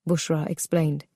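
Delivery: ordinary delivery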